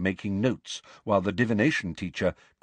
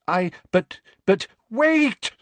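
higher and louder, blurted; sudden outburst; 12 semitones higher, 7 dB louder, slower than usual. A narrator's reading. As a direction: cry out all of a sudden. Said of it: "said Professor Trelawney suddenly".

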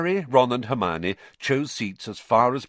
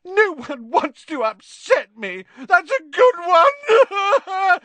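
slow, very high, loud tearful howl; wailing ghost girl breaking into sobs; 10 dB louder, slower than usual. Acted. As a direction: howl it bitterly, drawing out the words. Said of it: "howled Myrtle".

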